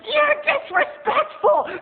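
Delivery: very high, slow, loud; shrill diva mom. Retold or imitated. Mom scolding clerk, very high slow. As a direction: shriek it slowly. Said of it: "And she's like".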